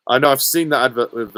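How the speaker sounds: normal talking voice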